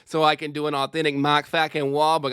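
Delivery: hammed-up, sweary Boston tough talk; Boston accent. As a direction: lay on a thick Boston accent. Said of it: "looking up a fucking Boston accent".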